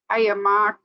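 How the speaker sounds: put-on high, playful stab at a Long Island accent; amateur Long Island accent greeting; attempted Long Island accent. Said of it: "I can't talk with that accent".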